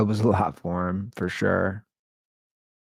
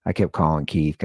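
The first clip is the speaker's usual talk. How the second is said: ordinary talk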